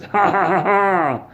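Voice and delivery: high, booming 'ha ha ha' cackle; mock villain laugh. Immortal Todd laughs at his attackers, triumphant mock laugh. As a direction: laugh like an unkillable god, hammed up. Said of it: "he just starts laughing".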